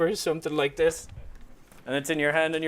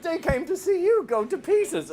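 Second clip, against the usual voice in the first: high, slow, laughing through the words; 5 dB louder, slower than usual. Retold or imitated. Classmate explaining the crowd, slow high laugh. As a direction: savor the doom while laughing.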